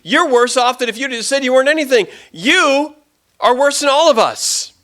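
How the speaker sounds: high, loud scornful tirade; mocking. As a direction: heap contempt on him, building to a shout. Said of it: "everyone is mocking him".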